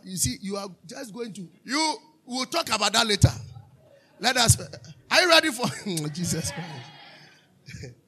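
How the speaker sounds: rally the room with a shout; shouted call. Acted. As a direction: pastor shouting to the church about Jesus, loud. Preacher shouting to congregation.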